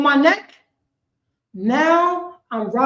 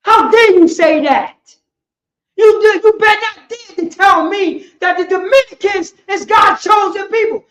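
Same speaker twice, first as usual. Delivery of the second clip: full-force preacher shouting, higher and louder; preaching shout; 7 semitones higher, 6 dB louder than usual. Real, not acted. Street preacher rebuking the accusers, shouting hard. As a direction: roar it from the pulpit with outrage.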